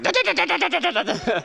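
high, choppy mock chattering; angry squirrel chatter. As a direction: scold like a furious squirrel up a tree. Squirrel scolding from the tree.

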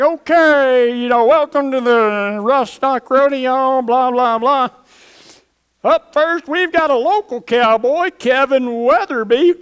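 announcer welcoming the crowd and calling the first rider; rodeo announcer. Retold or imitated. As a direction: boom it out over the arena crowd.

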